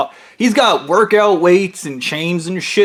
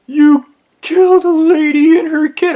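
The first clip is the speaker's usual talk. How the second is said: high, loud accusing voice; hammed-up accuser; 11 semitones higher, 4 dB louder than usual. Coworker accuses the narrator, high mock accusation. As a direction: point the finger in a squeaky put-on voice.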